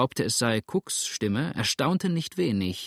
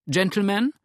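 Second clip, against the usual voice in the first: sharp call to attention; 8 semitones higher, 4 dB louder than usual. A narrator's reading. Stranger interrupts loudly.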